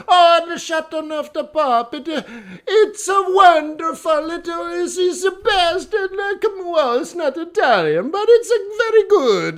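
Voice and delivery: put-on very high, loud caricature Italian accent; over-the-top effusive Italian accent. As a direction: rave then grudgingly concede, thick mock Italian accent. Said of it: "and he's Italian".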